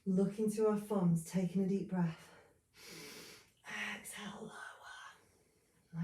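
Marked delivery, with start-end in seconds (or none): 4.0-6.0 real soft whisper, drawn out slowly; hushed, slow yoga-teacher whisper. Real, not acted. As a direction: whisper a slow instruction on the out-breath. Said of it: "I don't know why I'm whispering".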